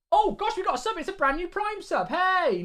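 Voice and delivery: high-pitched delighted greeting; excited surprise. Real, not acted. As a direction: react to a welcome surprise, voice jumping up. New subscriber alert interrupts him.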